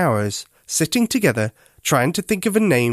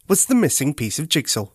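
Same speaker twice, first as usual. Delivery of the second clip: plain reading voice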